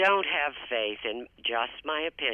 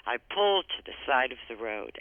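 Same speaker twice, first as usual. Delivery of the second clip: ordinary delivery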